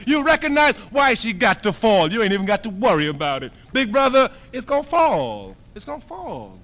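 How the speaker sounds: ordinary delivery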